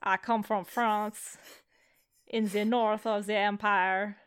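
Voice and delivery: hammed-up fake French accent, slow; a deliberately bad French accent for the cousin. GM voicing the French cousin in a bad French accent. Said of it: "I need to hear your French accent immediately".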